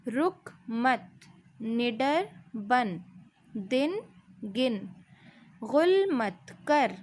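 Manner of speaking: slow, each word set apart; slow reading demo. Shown as a demonstration. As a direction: read word by word for the children. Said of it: "ध्यान से सुनिए". (धीमे-धीमे, लफ़्ज़-ब-लफ़्ज़)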